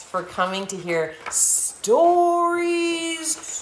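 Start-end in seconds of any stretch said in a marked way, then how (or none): 1.3-3.6 high, slow show-title sing-out; announcer flourish. Real, not acted. Host thanks cadets, announcing the show title. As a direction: present the show name grandly.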